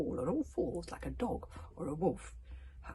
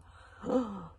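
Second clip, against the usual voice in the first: 1 horrified gasp; 2 semitones higher, 4 dB louder than usual. A narrator's reading. Gasp in horror.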